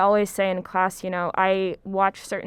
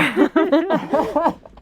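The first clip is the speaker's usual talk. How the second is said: loud laugh; 8 semitones higher, 5 dB louder than usual. Real, not acted. Laughing at colleague's remark.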